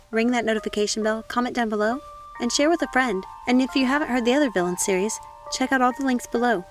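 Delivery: usual speaking voice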